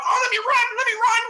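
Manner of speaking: high, loud, whiny mocking voice; whiny mock Lamar. Mocking Lamar's scrambling, in a high whiny voice. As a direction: whine it like a panicked kid. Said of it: "instead of moving around the pocket".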